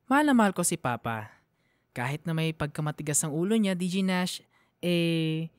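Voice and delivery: ordinary talk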